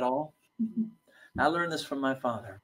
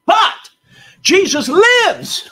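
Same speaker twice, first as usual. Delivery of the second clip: high, loud, slow "Jesus lives"; triumphant declaration; 13 dB louder, much slower than usual. Retold or imitated. Wife answering the atheist guide, a high loud proclamation. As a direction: proclaim it like a victory.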